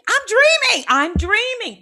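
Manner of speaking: very high, loud shrieking of dreaming; overjoyed screaming; ecstatic shriek. Retold or imitated. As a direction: scream it in giddy relief. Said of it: "This is how I was in the dream".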